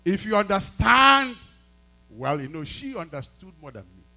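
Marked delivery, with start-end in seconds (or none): 0.0-1.4 heated retort. Retold or imitated. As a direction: argue back hard, stretching the last words. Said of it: "I said to her".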